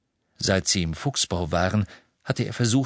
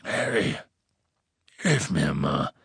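low, hoarse call for help; gruff, husky plea for a hand; 5 semitones lower than usual. A narrator's reading. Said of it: "rief Hagrid heiser".

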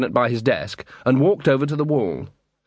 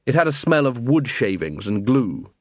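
ordinary delivery, nothing marked

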